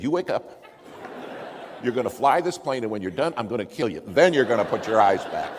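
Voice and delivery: shouting at the sick pilot in panic; loud, raging threat; furious yell. Retold or imitated. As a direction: wake him up and threaten him, full volume. Pilot faints, speaker furious.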